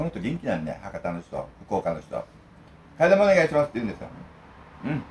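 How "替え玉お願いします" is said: loud, high call for a refill; loud order. Retold or imitated. Fukuoka local loudly ordering a refill, loud. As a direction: belt out the noodle refill order proudly. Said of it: "大きな声で言うんですよ".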